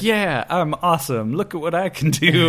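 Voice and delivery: lightly voiced cocky celebrity, with a laugh; imitating careless show-off. Celebrity wizard to his admirers, mock carefree boasting. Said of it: "he just doesn't care".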